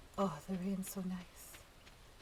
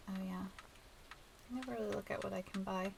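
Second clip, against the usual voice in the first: normal talking voice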